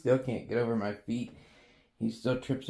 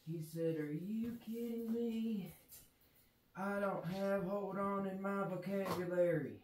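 voicing the pet; 3 semitones higher, 9 dB quieter, slower than usual. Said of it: "And he says".

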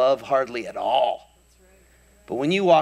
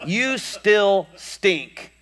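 slow blunt verdict; 8 semitones higher, much slower than usual. Real, not acted. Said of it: "slow punchline".

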